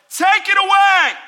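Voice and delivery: shouted high and loud, begging God; pleading shout. Acted. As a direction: cry it out like a desperate plea. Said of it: "Paul's begging God".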